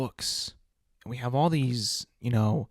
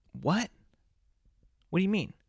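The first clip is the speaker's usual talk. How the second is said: pitched higher, incredulous questions; can't believe what he just heard; baffled friend; 7 semitones higher, faster than usual. Acted. Friend hearing he wants to find himself, disbelieving and higher.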